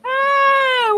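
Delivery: high, loud drawn-out squeal; excited squeal. Real, not acted. Streamer squealing to viewers, high excited scream.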